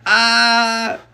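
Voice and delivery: high, loud crowd's Ah; crowd gasp. A narrator's reading. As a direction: gasp it like a crowd of kids watching a fall. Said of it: "The crowd of watching children went".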